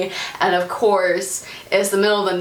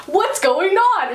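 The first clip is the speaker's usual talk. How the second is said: high, drawn-out acted question; slow, high confused cry; mock alarm; 11 semitones higher, slower than usual. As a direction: pitch it up in comic bewilderment. Freddy voiced, alarmed.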